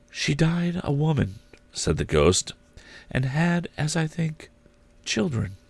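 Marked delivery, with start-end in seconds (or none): 0.1-1.4 soft, much higher ghostly voice; ghost's voice. Acted. Ghost gently telling Scrooge his sister died, soft and high. 3.1-5.6 soft, much higher ghostly voice; ghost's voice. Acted. Ghost gently telling Scrooge his sister died, soft and high.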